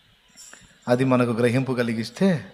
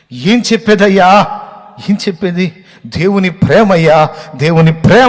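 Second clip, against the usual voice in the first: loud, high weeping cry; man of God crying aloud; 7 semitones higher, 12 dB louder than usual. Retold or imitated. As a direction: sob out the love of God. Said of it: "ఆయన ఏడుస్తూ".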